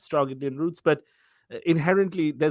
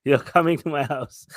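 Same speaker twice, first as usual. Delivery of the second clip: hammed-up host insisting, louder; insistent host; 5 dB louder than usual. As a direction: refuse to take no for an answer. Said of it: "He said".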